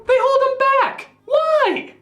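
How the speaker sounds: very high, incredulous squawk; exasperated outcry. Acted. Critic rants that Frodo gets held back, exasperated. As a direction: cry out in baffled frustration. Baffled rant at the characters.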